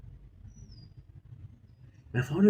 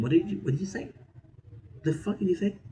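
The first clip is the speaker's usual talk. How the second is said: indignant retort; 8 semitones higher, 4 dB quieter than usual. Retold or imitated. Confronting father about his remark.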